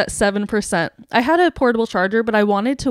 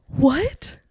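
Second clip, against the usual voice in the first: higher, questioning stunned 'what'; retold disbelief; 4 semitones higher than usual. Told it is an easy fix, stunned disbelief. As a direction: ask it as if stunned, rising.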